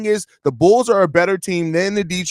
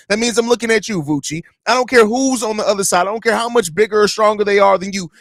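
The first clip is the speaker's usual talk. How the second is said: normal talking voice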